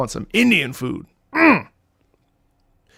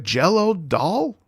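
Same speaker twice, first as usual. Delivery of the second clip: confused; 4 semitones higher, slower than usual. Retold or imitated. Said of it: "And I was like".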